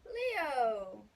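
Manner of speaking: loud call across the beach. Acted. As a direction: shout the name, searching. Calling for lost Leo.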